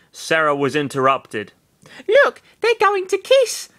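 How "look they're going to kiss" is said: put-on high excited girl voice. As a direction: point it out, high and eager. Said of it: "Florina whispered".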